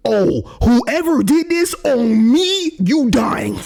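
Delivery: high, slow mock menace; mock threat. Imitating the enraged girl in the image, mock threatening. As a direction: parody her angry face. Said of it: "She like".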